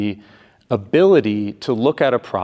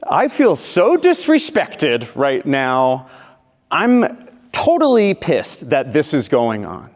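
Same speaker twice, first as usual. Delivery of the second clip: slow, emphatic, louder acted outrage; angry girlfriend; 4 semitones higher, 5 dB louder, slower than usual. Girlfriend finds dirty dishes in the sink, acted angry. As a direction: lay into each word with indignant vitriol. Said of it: "Same vitriol as before".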